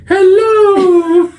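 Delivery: very high, loud drawn-out falsetto greeting; Missus Doubtfire. Acted. Co-host's only British accent attempt, shrieked falsetto greeting. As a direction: whoop it out in a bad British accent. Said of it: "I just think of Missus Doubtfire going".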